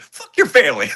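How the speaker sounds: high, mocking curse; possessed lead's taunt. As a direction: throw it back as a taunt. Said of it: "she looks back and says".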